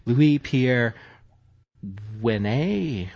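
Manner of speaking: names said with a French accent, Louis stressed; podcast host tries a French accent on Louis Pierre Wene. Shown as a demonstration. Host reading the French executive's name in a French accent. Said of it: "I have to apologize for my French accent".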